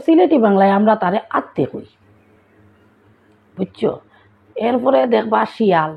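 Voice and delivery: ordinary talk, nothing marked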